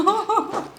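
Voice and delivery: bright burst of high laughter. Real, not acted. Laughing at own mistake.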